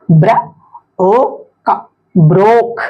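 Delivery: ब्र, ओ, का apart, then ब्रोक; sounding out. Shown as a demonstration. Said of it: "इसको पढ़ के देखते हैं".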